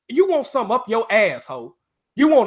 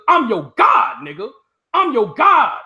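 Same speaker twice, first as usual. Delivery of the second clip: boastful shout; 4 semitones higher, 5 dB louder, slower than usual. Real, not acted. Boasting at chat, raised voice.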